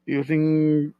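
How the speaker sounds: ordinary delivery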